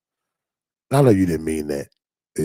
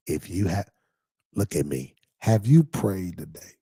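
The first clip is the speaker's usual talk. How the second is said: ordinary talk, nothing marked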